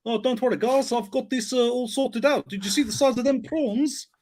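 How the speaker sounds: high-pitched mock South African accent; Kenneth Branagh. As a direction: parody a badly done South African accent. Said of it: "He's doing a South African accent".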